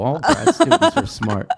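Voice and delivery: laughing compliment to a grad student. Real, not acted. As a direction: tease with a laugh.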